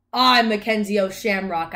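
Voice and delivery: loud, indignant announcement; loud leprechaun shout. A narrator's reading. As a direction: shout your name at an intruder. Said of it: "I shouted".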